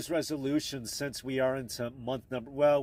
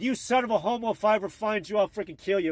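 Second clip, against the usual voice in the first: retold scream; 6 semitones higher than usual. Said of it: "he screamed at us".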